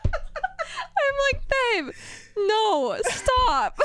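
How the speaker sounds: high laughing "babe, no, stop"; laughing plea. Retold or imitated. Wife laughing at husband shaking the bed, high laughing plea. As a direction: beg him to stop through laughter.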